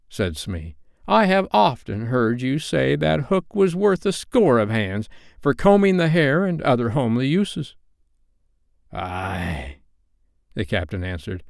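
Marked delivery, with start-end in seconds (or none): 1.1-7.7 higher-pitched, simple-minded sidekick; bosun character. A narrator's reading. Smee reasoning with his captain, high bosun voice. 8.9-9.8 lightly voiced pirate captain, softer; a drawn, quiet captain's reply; captain character. A narrator's reading. Hook answering his bosun, lightly voiced captain. Hook's voice in story.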